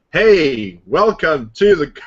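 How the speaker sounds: stiff, slow, nervous robotic greeting. Retold or imitated. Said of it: "I'm just sounding all robotic".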